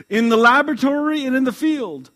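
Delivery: loud, high proud claim; proud boast. Acted. Mother boasting her job to the clerk, loud. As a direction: boast it grandly.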